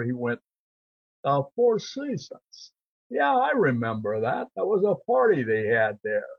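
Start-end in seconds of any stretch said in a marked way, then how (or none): 1.2-6.2 John Wayne impression. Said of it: "you can mimic a lot of people".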